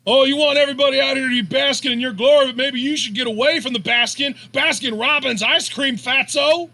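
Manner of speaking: high, loud, fast heckling; Cameron Grimes. Retold or imitated. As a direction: rattle off a taunting wrestler promo. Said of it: "That's a Cameron Ro-, Grimes joke".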